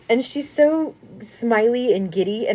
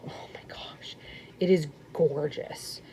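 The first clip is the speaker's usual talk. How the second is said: hushed whisper of wonder, much softer; awed whisper; 4 semitones lower, 9 dB quieter than usual. Real, not acted. Admiring the video's blanket, in an awed whisper. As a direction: breathe it out in awe.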